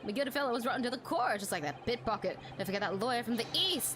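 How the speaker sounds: ordinary talk, nothing marked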